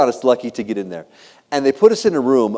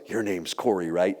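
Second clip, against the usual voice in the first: low, soft, creepy murmur; imitated creepy whisper; 7 semitones lower, 6 dB quieter, slower than usual. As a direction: lean in close and lower the voice, mock-creepy. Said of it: "He starts to whisper into my ear".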